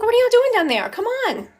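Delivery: dog's voice. Pretend. Owner voicing her dog waiting upstairs, playful high mock voice. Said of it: "She's like".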